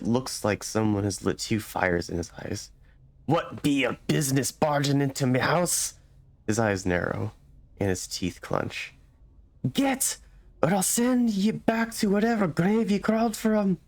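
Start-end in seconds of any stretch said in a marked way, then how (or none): 3.3-5.9 a hostile homeowner challenging an intruder; gruff villager. Acted. Angry villager confronts stranger in his house, rough hostile voice. 9.7-13.8 a hostile homeowner challenging an intruder; gruff villager. Acted. Angry villager confronts stranger in his house, rough hostile voice.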